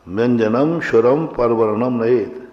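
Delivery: व्यंजनम स्वरम परवर्णम नयेत with every letter read out in full; deliberately wrong full-syllable reading; wrong recitation. Shown as a demonstration. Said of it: "देखो, आप अशुद्ध उच्चारण कर रहा हूँ". (अशुद्ध उच्चारण का प्रदर्शन)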